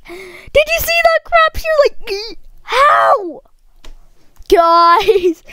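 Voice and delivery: high-pitched screech of disbelief; screaming. Real, not acted. Player asking viewers if they saw that, screaming in disbelief. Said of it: "sorry for screaming into the mic".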